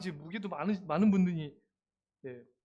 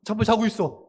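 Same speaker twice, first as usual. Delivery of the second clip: ordinary talk, nothing marked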